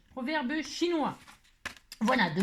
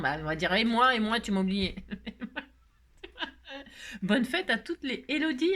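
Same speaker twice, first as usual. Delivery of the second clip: usual speaking voice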